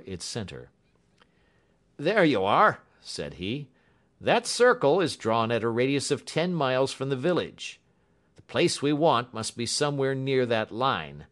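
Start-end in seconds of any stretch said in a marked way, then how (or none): 2.0-2.8 loud, higher-pitched announcement; pitched up, pleased-to-show delivery; announcing. A narrator's reading. Bradstreet announcing his drawn circle. 4.2-11.2 lightly voiced inspector, higher and louder; a hint of an official briefing; inspector explaining. A narrator's reading. Voices Bradstreet explaining the map.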